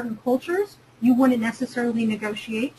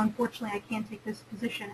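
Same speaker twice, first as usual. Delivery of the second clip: much softer, declining the offer gently; soft polite refusal; 2 semitones lower, 12 dB quieter, faster than usual. Pretend. Turning down a job offer from the recruiter, softly. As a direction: let the words drop quietly, a polite no.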